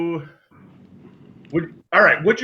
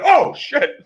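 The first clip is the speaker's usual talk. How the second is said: shocked dad; 11 semitones higher than usual. Retold or imitated. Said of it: "my dad was like".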